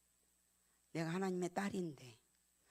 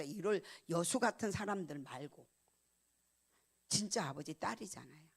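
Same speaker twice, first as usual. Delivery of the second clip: ordinary talk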